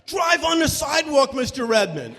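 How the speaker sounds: high, loud, urgent calling out; urgent shouting. Retold or imitated. Player spotting the teacher outside, high loud urgent. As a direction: point her out, hurry. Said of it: "And one boy goes".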